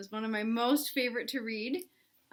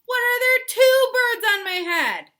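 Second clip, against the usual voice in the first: high, loud outraged cry; hammed-up alarm, much higher; shocked shout; 14 dB louder than usual. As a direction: yell in disbelief. Gerald shocked.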